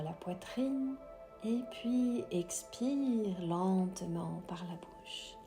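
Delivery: soft, slow whispered meditation voice; guided meditation. Acted. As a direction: guide the breathing gently, very slowly. Leads a breathing meditation.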